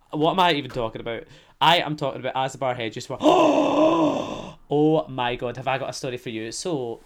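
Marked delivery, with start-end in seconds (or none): 3.2-4.7 big high gasp before the gossip; dramatic gasp. Real, not acted. A theatrical gasp before telling listeners the story. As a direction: gasp loudly as if shocked.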